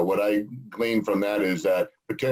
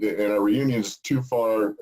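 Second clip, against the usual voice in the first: usual speaking voice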